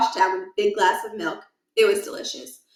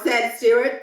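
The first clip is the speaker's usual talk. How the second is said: usual speaking voice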